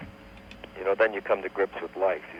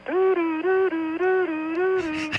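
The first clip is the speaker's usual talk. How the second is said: very high, even two-note "doo" wail; British siren imitation; much slower than usual. Storyteller ending joke with British ambulance, high siren imitation. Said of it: "with the siren going".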